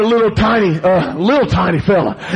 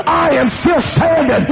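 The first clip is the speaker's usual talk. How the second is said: higher, full-force preacher's proclamation; defiant declaration; 6 semitones higher, slower than usual. Real, not acted. Preacher stands against the devil, declaring it forcefully. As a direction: proclaim it defiantly to the whole church.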